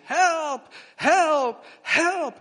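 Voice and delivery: high, loud panicked cries; screaming for help. Retold or imitated. Officer chased by a bull, screaming high and loud. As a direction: scream for help at the top of your voice. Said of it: "he heard this loud screaming".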